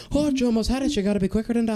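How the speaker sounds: high-pitched Irish ad impression; Irish accent. Quoting the fishing-pole State Farm ad, high Irish accent. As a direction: put on a high, lilting Irish voice. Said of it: "the only thing I can say that sounds Irish".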